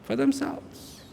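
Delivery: breathless whisper of the same words; whispered aside. Real, not acted. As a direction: drop it to a whisper. Repeats answer under breath.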